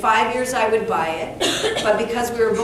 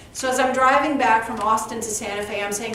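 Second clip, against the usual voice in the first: ordinary talk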